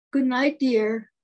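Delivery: lightly voiced wife, slow and emphatic; wife's bedtime goodnight. A narrator's reading. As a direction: call goodnight to a husband, slowly. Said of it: "says her husband".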